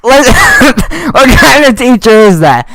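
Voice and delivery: slow, high, laughing question; laughing disbelief. Real, not acted. Mocking the teacher's threat.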